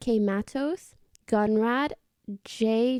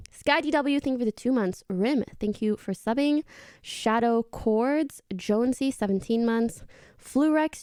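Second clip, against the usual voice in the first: ordinary talk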